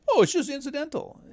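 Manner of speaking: high, squeaky parody of a dismissive excuse; mocking falsetto. Mocking a conservative guest's excuse, high mock voice. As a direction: sneer it in a silly high voice.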